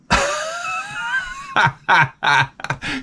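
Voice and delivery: high squeal into a laugh; hammed-up high-pitched cackle; put-on laugh. Comic laugh after offering a cherry.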